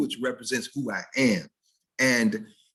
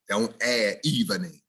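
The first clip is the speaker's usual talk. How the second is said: usual speaking voice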